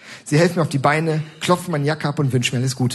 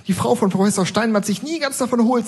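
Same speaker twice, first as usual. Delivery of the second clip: put-on higher, serious old lady voice; gravely pitched-up granny; earnest old woman; 10 semitones higher than usual. Old woman telling the narrator solemnly, higher old lady voice. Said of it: "sagt sie ernst".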